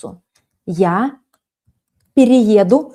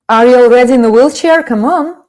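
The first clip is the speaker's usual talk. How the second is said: teasing; 7 dB louder, faster than usual. Real, not acted. Teasing a student's joke.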